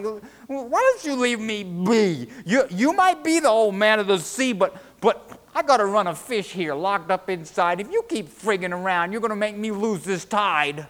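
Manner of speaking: high, loud, stammering plea; flustered, indignant protest. A narrator's reading. Charlie begging to be left to his fish, flustered. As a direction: plead your case at full volume, rattled.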